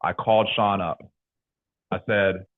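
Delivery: ordinary talk, nothing marked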